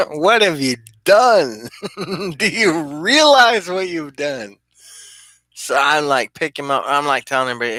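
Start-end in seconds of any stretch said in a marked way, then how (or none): 0.0-4.6 higher, laughing through the words; giggling disbelief at the friend who broke the table. Retold or imitated. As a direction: crack up while scolding him, slowly. Laughing repeat of the quote.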